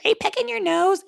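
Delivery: high-pitched imitation of nosy people; mock teasing question. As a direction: mimic a nosy onlooker way up high.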